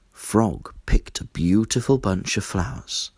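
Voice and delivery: plain reading voice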